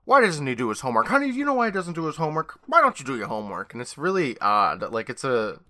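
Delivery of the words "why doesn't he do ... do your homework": rapid-fire mock nagging; manic dad. Co-host imitates Dad nagging about homework, manic. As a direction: fire off questions, jumping high midway. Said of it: "he's all like".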